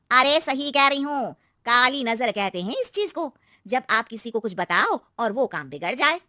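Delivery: slow, drawn-out aunty warning; ominous neighbour aunty. Acted. Aunty explaining the evil eye, slow and ominous. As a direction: stretch the words to spook her.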